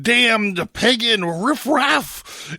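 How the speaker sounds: high, slow, drawn-out cursing; indignant curse. A narrator's reading. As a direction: spit out the insult with theatrical outrage. Red One curses at the narrator.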